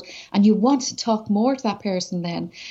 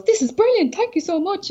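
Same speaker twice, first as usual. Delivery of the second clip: much higher, gushing thanks from the barman; imitating a thrilled barman; excited thanks; 11 semitones higher than usual. Pub guy thanks her gushingly.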